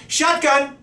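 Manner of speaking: high, loud call-out; yell. Acted. Friend calling the front seat on the way to the car, yelling. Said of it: "someone yells".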